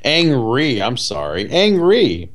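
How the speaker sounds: put-on Chinese accent, "Angry" said for his name; hammed-up Chinese accent mixing up angry and Ang Lee; mock Ang Lee accent. Ang Lee correcting his name, mock Chinese accent. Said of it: "impression of Ang Lee".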